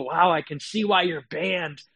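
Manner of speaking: ordinary delivery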